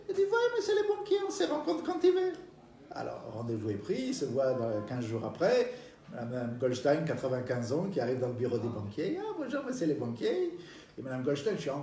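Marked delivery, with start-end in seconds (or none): 0.3-2.3 put-on old lady voice. Old client answers the banker. 9.2-10.6 put-on old lady voice. Old lady greets banker.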